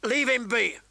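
high, forceful rasped warning; rasping warning. A narrator's reading. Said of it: "a rasping voice warned".

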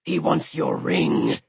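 low, slow creature voice; deep menacing dragon growl. A narrator's reading. Dragon voice talking.

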